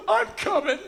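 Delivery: very high, loud mock-tearful cry; hammed-up emotional yelp; overcome with emotion. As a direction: squeal it as if nearly in tears. I'm nearly in tears.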